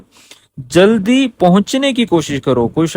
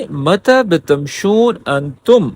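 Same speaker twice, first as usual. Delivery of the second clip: slow careful repeat; much slower than usual. Shown as a demonstration. Teacher repeating the clip question for learners, slowly. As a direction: say متى بتمشون أنتم slowly and clearly. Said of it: "مکمل سن لیں".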